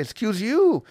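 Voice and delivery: indignant high "Excuse you"; high intonation. Retold or imitated. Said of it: "with a high intonation".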